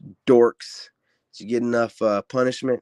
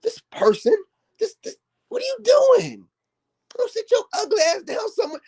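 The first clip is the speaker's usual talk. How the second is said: hammed-up sobbing, much higher; mocking whine of a hurt poster, pitched way up; mock crying whine. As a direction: wail it like a fake crybaby. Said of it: "I'm like".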